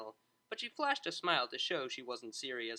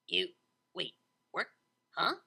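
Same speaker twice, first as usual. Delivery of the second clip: confused stammer; 2 semitones higher, 4 dB louder than usual. A narrator's reading. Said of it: "Vinyl asked eloquently".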